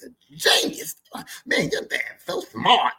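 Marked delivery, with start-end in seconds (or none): none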